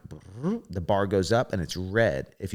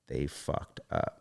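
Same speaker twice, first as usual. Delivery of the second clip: low disbelieving mutter; 8 semitones lower, 8 dB quieter, slower than usual. Retold or imitated. Retold disbelief at making finals.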